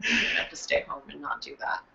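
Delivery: whispered excuse, resting the voice; hushed, saving voice. Retold or imitated. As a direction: breathe the words to spare the voice. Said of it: "and I was like".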